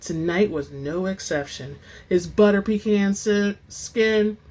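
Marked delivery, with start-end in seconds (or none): none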